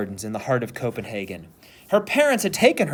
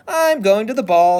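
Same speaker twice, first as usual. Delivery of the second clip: high sing-song little girl pretending; 8 semitones higher, 6 dB louder than usual. A narrator's reading. Little girl playing dolls, high pretend voice. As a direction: play-act a grand lady, pitched up. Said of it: "in a high pretend voice".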